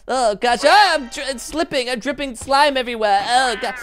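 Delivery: high, whiny, clumsy character voice; Gary. Acted. Player performing an impression to impress Aphmau, high fretting voice. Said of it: "no Gary impression".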